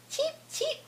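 tiny, very high chick peeps; chick cheeping. A narrator's reading. Mother reads the chicks' cry to her toddler, high cheeping. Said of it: "cry the chicks".

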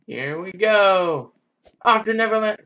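high, loud stretched-out cheer; excited cheer. Real, not acted. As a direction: launch into it with glee. Launching off the zip line.

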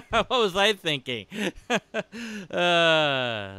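laughing at own joke. Real, not acted. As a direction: chuckle through the words.

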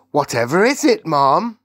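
put-on high, loud cheerful old maid; bright morning greeting from an old servant. A narrator's reading. Maid worried.

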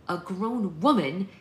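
loud, slow, rising disbelief; outraged questioning repeat; indignant echo. A narrator's reading. Daughter throws mother's words back.

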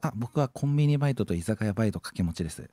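a streamer on a scam call playing a smooth young man; put-on cool voice. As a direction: play the smooth handsome guy on the call. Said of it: "俺のイケボに騙されてら".

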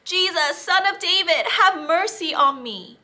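high, loud plea for mercy; calling out loudly. Acted. Blind beggar calls to Jesus over the crowd, loud and high. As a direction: cry out to be heard over the crowd. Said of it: "called out loudly".

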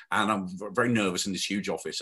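ordinary delivery

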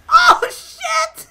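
very loud, high shriek; real high-pitched yell; startled scream. Real, not acted. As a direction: explode in shock. Startled by crash in game.

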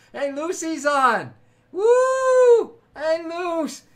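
loud, high whooping welcome; excited greeting. Real, not acted. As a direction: cheer a friend's arrival with a big woo. Greets Lucy joining the stream.

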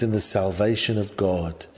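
ordinary talk, nothing marked

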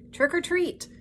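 high-pitched trick-or-treat call; Pooh calling out. A narrator's reading. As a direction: sing out the greeting at the door. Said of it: "Pooh cried".

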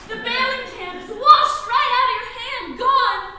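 loud, high dramatic narration; urgent alarm. Pretend. Telling husband the bailing can is lost, alarmed. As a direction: hurl the disaster at the listener. Acting out disaster at sea.

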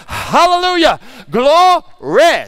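high, loud, two-syllable glo-ry; spirit-filled shout. Retold or imitated. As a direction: shout praise like a churchgoer. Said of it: "she's like".

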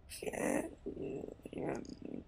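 faint, breathy and drawn-out, like a failing signal; breaking-up transmission. Acted. Stranded caller trying to reach the player, faint broken transmission. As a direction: strain softly through a bad connection, slowly. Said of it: "signal is getting".

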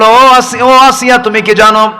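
high, loud chanted threat; acted fury of a ruler threatening his wife; enraged Pharaoh. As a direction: thunder it out in a preacher's intoned rage. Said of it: "ফেরাউন খেপে গিয়ে বলল".

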